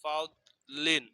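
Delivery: ফাউদলিন drawn out slowly, syllable by syllable; slow syllable blending. Shown as a demonstration. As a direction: stretch ফাউদলিন slowly for learners. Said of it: "লাম দুই জের লিন".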